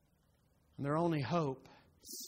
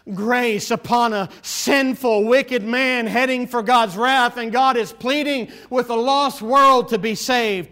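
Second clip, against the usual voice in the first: high, loud, impassioned pulpit cry; full-force preaching; 5 semitones higher, 10 dB louder than usual. Real, not acted. Preacher tells a lost world God is pleading, full-force preaching. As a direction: build to a loud, drawn-out sermon climax.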